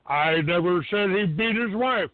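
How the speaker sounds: usual speaking voice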